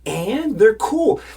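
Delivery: high, loud comeback; imitated defiant retort. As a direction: shout back defiantly as the woman. Woman defending them in acted quarrel.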